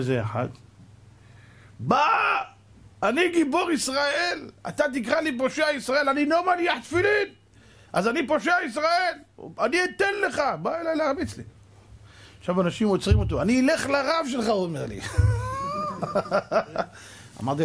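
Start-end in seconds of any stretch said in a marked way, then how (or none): 3.0-11.4 angry heckler. Retold or imitated. Secular mourner yells at the rabbi, furious high shout. Angry man at memorial voiced. 13.4-14.5 higher, indignant threat; mock angry threat. Heckler threatens to complain, higher indignant voice. 15.0-16.8 high crying laugh. Real, not acted. Preacher laughs at his own story, high laugh.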